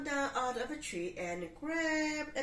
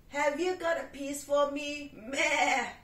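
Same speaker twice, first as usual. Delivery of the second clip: louder goat with a bleat; 3 semitones higher, 9 dB louder than usual. Acted. Goat bleating at the cat for a slice.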